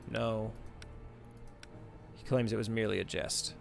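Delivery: usual speaking voice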